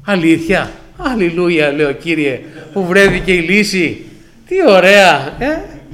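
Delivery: loud, overjoyed hallelujah, hammed up with irony; mock joyful cheer. To the insurer about the fake crash, loud mock joy. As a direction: cheer the good news a little too loudly.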